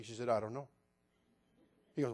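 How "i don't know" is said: low, softened offhand quote; calm noncommittal answer. Retold or imitated. Telling his brother her answer, imitating her calm. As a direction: quote it casually, a little quieter. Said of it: "that's how she said it".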